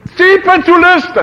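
high, loud anguished cry. Shown as a demonstration. Preacher showing the cry from the cross, loud and high. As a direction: let the cry ring out. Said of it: "Ce-i cu țipătul ăsta?".

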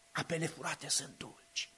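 very soft, low seductive murmur; folly's whisper. Acted. Preacher voicing Folly tempting, soft low murmur. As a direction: breathe it like a secret temptation. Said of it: "Strigă".

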